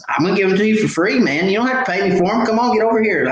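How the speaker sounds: high, fast, eager coaxing; coaxing a shy kid. Retold or imitated. Man offering a timid boy free baits, high eager coaxing. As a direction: win over a shy boy, quick and bright.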